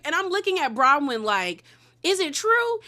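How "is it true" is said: high, pointed is it true; mock-innocent doubting; skeptical. Recapper eyeing Bronwyn skeptically, high pointed question.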